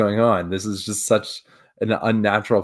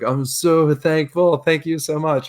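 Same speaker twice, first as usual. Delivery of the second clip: gushing thanks; 7 semitones higher, 4 dB louder than usual. Retold or imitated. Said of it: "just being like".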